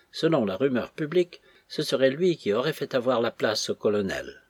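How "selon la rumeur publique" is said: plain reading voice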